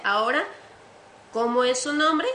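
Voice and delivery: usual speaking voice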